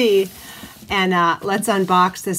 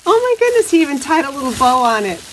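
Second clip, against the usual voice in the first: much higher, louder, fast squeal of delight; excited squeal; 5 semitones higher, 5 dB louder, faster than usual. Real, not acted. Spotting the bow the maker tied, a high loud squeal. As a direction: squeal as if it's a birthday present.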